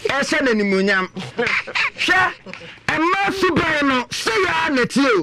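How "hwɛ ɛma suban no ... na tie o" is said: high raised call to the listeners; slow rising shout, demanding attention. Acted. Host calling out to the listeners for attention.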